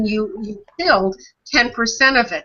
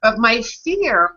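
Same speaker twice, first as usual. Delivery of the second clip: ordinary delivery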